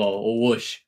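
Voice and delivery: ordinary delivery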